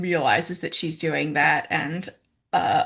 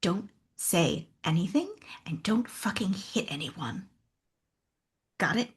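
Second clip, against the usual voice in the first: huffy whisper; 5 dB quieter than usual. Acted.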